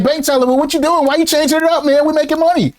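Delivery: high-pitched, exasperated complaint; acted protest. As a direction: plead in disbelief, voice riding high. Users pleading with Bank Teller.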